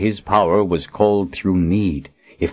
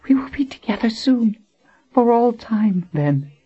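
farewell whisper. Acted. Dying Colin bids Richard farewell, whispered. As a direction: whisper a last goodbye.

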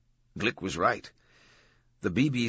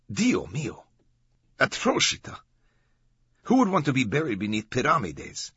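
put-on higher, lively horrified old-world guide; appalled docent; 6 semitones higher, 4 dB louder than usual. A narrator's reading. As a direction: exclaim it like a scandalized docent.

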